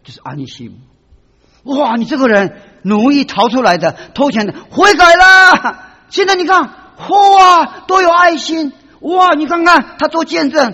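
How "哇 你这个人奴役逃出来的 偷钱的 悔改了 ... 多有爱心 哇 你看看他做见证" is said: loud, high wonder; excited marvel. Acted. Preacher marvelling at the reformed runaway slave, excited. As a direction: gush over the changed man.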